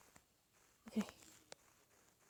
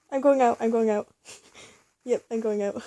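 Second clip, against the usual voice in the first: normal talking voice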